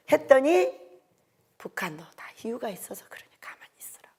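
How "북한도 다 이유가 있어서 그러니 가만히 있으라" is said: hushed divine voice. Retold or imitated. God's reply, retold.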